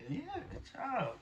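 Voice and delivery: high, slow, sing-song praise. Real, not acted. Dad praising son fetching his ball, high slow praise.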